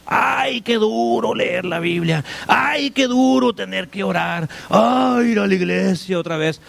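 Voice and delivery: lightly whiny mock lament; a hint of a grumbling believer sighing; mock complaining churchgoer. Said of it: "hay gente que soporta a Dios".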